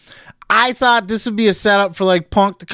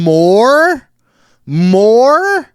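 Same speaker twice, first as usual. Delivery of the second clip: incredulous outburst; 5 semitones higher, 6 dB louder than usual. Real, not acted. Outraged at more punishment.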